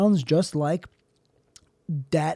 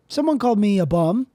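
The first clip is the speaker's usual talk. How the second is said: usual speaking voice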